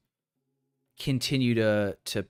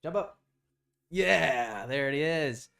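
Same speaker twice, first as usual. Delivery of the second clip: higher-pitched, playful dog talk; coaxing a pet; 7 semitones higher, 3 dB louder than usual. Real, not acted. Owner calls the dog over to sell the viewers, coaxing.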